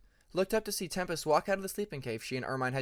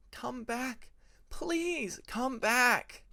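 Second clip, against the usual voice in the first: pleading cry of come back, pitched up high; thinking loudly; 9 semitones higher, much slower than usual. A narrator's reading. Peacemaker pleading for the dragon to come back, high desperate plea. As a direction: beg the dream to return. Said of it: "he thought, loudly".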